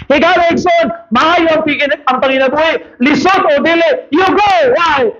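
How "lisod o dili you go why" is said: preaching shout. Acted. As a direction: call it out hard.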